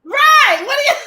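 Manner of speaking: high, loud laughing shout; cracking up. Real, not acted. Co-host agreeing about the insult, laughing loudly. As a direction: squeal it through real laughter.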